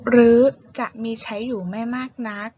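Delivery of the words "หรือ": หรือ called out loud and clear; teacher calls out หรือ to the class; loud announcement of the word. Shown as a demonstration. ครูประกาศคำว่า หรือ ให้นักเรียนฟังเสียงดังชัด. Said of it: "การอ่านคำออกเสียง".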